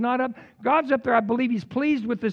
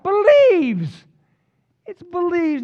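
shouted; 12 semitones higher, 8 dB louder, slower than usual. Real, not acted. Preacher punches the key word.

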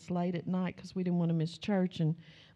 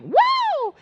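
very high, loud roller-coaster whoop; 9 dB louder than usual. Retold or imitated. Family whooping as the plane drops, very high and loud. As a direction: let it fly like a ride. Plane hits air pocket.

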